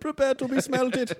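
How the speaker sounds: put-on high-pitched villain with German accent; mock threat in a German accent. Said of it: "that German accent is really good".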